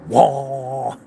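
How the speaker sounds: long drawn-out engine "waah"; imitated truck roar. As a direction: voice a truck revving hard, held long. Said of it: "you can hear my fucking truck going".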